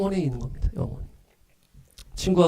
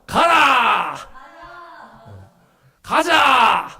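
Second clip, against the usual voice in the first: high, loud drawn-out battle cries; commanding shout; 11 semitones higher, 4 dB louder, much slower than usual. Shown as a demonstration. Preacher drills congregation in call and response, full shout. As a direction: bellow the order and the rallying reply. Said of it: "제가 "가라!" 하면 "가자!" 하시고".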